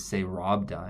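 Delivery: "say Robda" sounded out as English words; misread romanization. Shown as a demonstration. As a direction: read Robda with an English R, like the name Rob. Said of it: "that's not at all".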